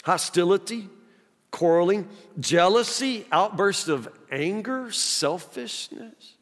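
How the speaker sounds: with feeling and slowness. Shown as a demonstration. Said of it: "read that again with feeling and slowness".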